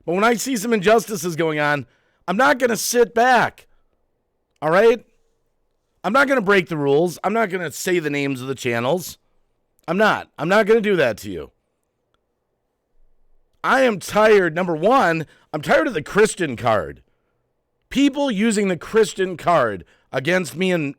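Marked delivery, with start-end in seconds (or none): 2.3-7.5 higher and louder, firm; defiant outburst. Real, not acted. Defiant vow against injustice. 13.6-17.0 fed-up outburst. Real, not acted. Angry complaint opens the list.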